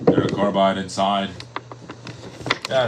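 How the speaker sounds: usual speaking voice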